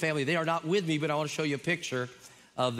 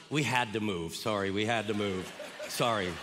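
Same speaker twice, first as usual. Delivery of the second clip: low-pitched, apologetic admission; sheepish; 6 semitones lower than usual. Retold or imitated. Church members apologizing to their pastor for moving away, sheepish. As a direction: sheepishly own up in a lowered voice. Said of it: "they're almost coming up sheepish".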